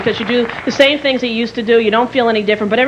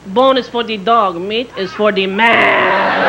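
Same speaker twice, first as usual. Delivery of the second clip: put-on slow, lower Mexican-accented suitor; mock Mexican accent; 5 semitones lower, much slower than usual. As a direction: draw out the last word in a put-on Mexican accent. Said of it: "Every Mexican guy was hitting on me".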